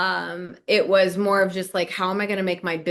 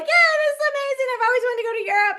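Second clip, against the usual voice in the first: much higher, fast gushing delight; thrilled husband; 3 dB louder, faster than usual. Retold or imitated. Husband thrilled about Europe, fast high excited gush. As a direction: gush like a kid at Christmas.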